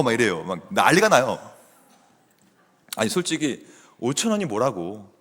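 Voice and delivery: ordinary delivery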